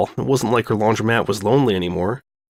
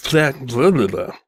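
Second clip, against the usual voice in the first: usual speaking voice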